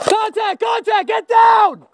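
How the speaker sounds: high urgent yelled warning; alarm shout. Real, not acted. Enemy spotted during airsoft game.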